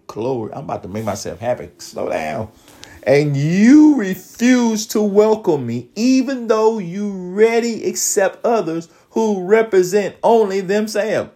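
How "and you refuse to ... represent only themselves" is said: higher-pitched preacher reading of the verse; fervent scripture reading. Acted. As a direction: proclaim it to the congregation.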